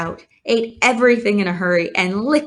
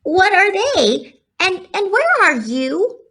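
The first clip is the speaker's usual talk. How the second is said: excited cry; 11 semitones higher than usual. Acted. Wilbur searching for the voice, excited cry. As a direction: blurt the questions in a rush. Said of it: "screamed Wilbur".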